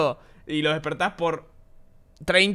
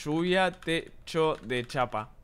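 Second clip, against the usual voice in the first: slow search read; 8 dB quieter, much slower than usual. Real, not acted. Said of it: "Eh, lluvia".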